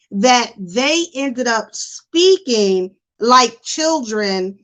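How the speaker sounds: ordinary delivery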